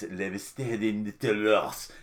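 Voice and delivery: amateur attempt at a French accent; best-effort French accent. Shown as a demonstration. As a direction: try your best French accent, poor pronunciation and all. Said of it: "that's my best, uh, French accent".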